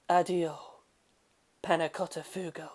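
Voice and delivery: muttered. A narrator's reading. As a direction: mutter it under your breath as the boat drifts off. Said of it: "muttered Giorno".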